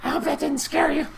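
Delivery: a hint of a goblin boss sweetly reassuring the guest; Little G. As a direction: turn suddenly polite. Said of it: "Little G goes".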